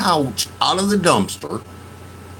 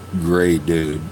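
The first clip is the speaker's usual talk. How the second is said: normal talking voice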